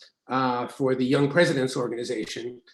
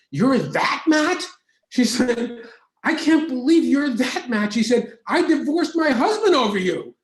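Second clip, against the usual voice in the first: higher, louder stunned disbelief; woman recognizing him, higher and louder in shock; astonished woman; 10 semitones higher, 5 dB louder than usual. Retold or imitated. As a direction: gasp it out as if starstruck. Woman's astonished double take.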